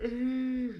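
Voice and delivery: big real yawn. Real, not acted. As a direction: let out a yawn mid-sentence. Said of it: "sorry, I'm yawning".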